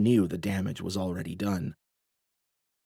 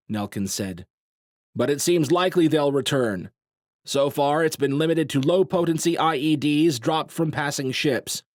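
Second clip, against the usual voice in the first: serious commander; 5 semitones higher, 4 dB louder than usual. A narrator's reading. Nelkin briefing the crowd.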